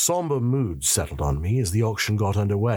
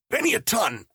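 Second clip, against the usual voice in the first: high-pitched, choked squeak; gasping; 13 semitones higher than usual. A narrator's reading. Seller held by the throat quoting a price, gasping. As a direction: squeeze it out past a gripped throat. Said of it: "I gasped".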